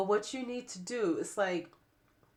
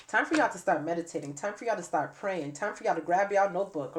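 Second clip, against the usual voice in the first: ordinary delivery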